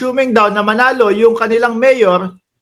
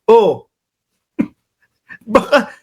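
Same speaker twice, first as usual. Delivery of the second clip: ordinary delivery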